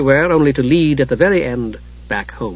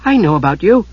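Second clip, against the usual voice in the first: wondering; 5 semitones higher than usual. A narrator's reading. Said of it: "stared at him in wonder".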